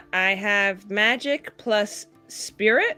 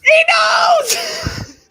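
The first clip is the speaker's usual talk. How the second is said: very high, loud mock-panic shriek; panicked shout; 11 dB louder than usual. Player reacting to Lord Vargas's look, panicked shriek. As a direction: shriek it, hammed-up alarm.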